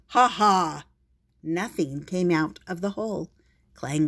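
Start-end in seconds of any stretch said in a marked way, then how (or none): 0.1-0.9 loud proud hero laugh; heroic laugh. Acted. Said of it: "put his fists on his hips and said".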